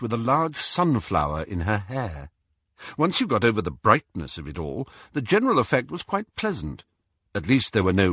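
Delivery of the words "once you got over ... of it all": usual speaking voice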